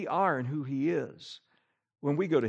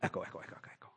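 mock echo; 11 semitones lower, 16 dB quieter, much faster than usual. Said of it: "prayers go something like this".

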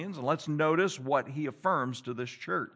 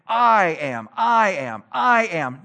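mock self-affirmation; 6 dB louder, slower than usual. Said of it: "These are self-affirmation phrases".

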